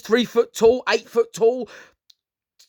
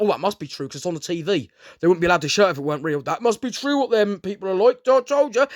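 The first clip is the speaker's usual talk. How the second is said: mock naive viewer; faster than usual. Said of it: "they're like".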